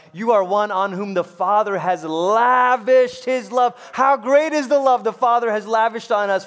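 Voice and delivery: loud, high, rising sermon climax; stretched words, big and declaring; preaching at full force. Acted. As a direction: preach it out to the back row.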